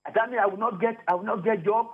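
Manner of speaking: usual speaking voice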